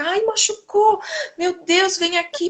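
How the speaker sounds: high, mock-panicked gasp of a fussing parent; alarmed fuss. Parent overreacting to a child's fall, mock panic. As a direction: ham up the overprotective parent.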